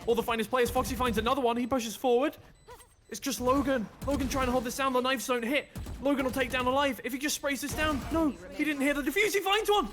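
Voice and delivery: high, building esports shoutcast; breathless-paced live call peaking on the climax; excited play-by-play. Real, not acted. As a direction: build from urgent commentary to a loud high cry.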